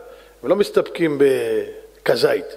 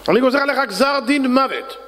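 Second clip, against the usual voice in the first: king's decree; 8 semitones higher than usual. Acted. King sentences the rabbi.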